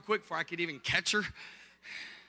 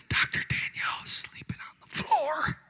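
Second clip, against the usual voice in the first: whimpering whisper. Retold or imitated. Husband confessing to pastor by phone, mock tearful whisper. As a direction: whisper breaking into a high squeak.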